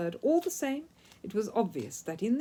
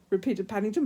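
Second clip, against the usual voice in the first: usual speaking voice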